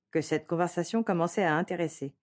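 ordinary delivery, nothing marked

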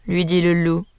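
plain reading voice

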